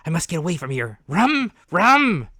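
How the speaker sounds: loud, high, rising cries; pleading for rum at full voice; desperate captain. A narrator's reading. Panicked captain begs the boy, shouting loud.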